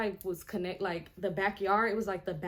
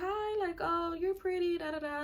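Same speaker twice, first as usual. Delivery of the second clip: much higher, imitated girly greeting; friendly greeting; 9 semitones higher than usual. Imitating cousin's girlfriend complimenting a newcomer, high friendly voice.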